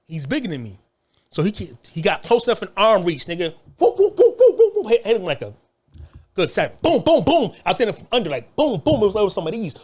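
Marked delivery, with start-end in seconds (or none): 3.8-4.9 much higher and louder whoop whoop; punch sound effects. Retold or imitated. Acting out hitting the cellmate, loud high punch noises. 6.8-7.5 much higher, louder boom boom boom; punch noises. Retold or imitated. Acting out the fight, loud booming punch noises.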